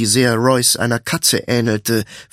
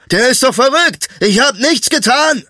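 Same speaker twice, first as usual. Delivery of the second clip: very high, frightened protest; alarmed shout; 11 semitones higher, 4 dB louder than usual. A narrator's reading. Butcher protesting innocence as the thief lunges, high alarmed shout. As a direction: cry out your innocence in alarm. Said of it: "rief der Fleischer".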